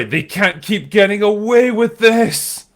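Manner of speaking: a hammed-up indignant meme line; meme quote voice. Host reading a viewer's meme quote, mock outraged. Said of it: "The Fixer says".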